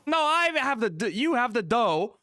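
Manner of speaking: high, loud dopey rich idiot voice. Acted. Rich idiot demanding dough from the baker, high and loud.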